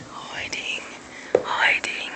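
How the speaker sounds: whispered wildlife narration; hushed nature documentary. Pretend. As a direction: hush it like a nature film.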